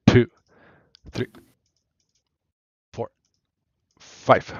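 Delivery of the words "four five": ordinary talk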